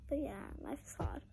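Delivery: barely a whisper, resigned; whispered sigh. Real, not acted. Girl to viewers about the top she missed, whispering. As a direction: let it fall to a whisper.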